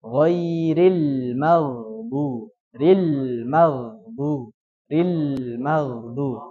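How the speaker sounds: Ghairil maghdubi alaihim recited slowly, l flowing; recitation demo. Shown as a demonstration. Teacher recites the verse slowly for students to copy. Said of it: "Tapi dialirkan".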